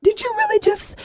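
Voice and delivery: high, then breathy whispered disbelief; talking to God in shock; mother in shock at God, rising to a whisper; stunned disbelief. Retold or imitated. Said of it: "I'm like".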